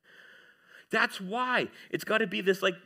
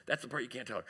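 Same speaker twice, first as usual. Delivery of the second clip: hushed aside; 5 semitones lower, 9 dB quieter, much faster than usual. Real, not acted. Quiet aside to the audience.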